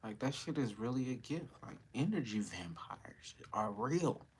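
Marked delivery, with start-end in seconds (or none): 1.6-4.3 slow secretive whisper. Real, not acted. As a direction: hush it like a secret. Conspiratorial aside about energy vampires.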